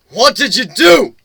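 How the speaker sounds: high, loud, slow demand; alarmed demand. Acted. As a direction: call out in alarm at what he did. Elder confronts the hero.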